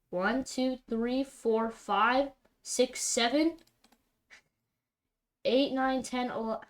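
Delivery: incredulous counting. Real, not acted. Counting lands again in disbelief.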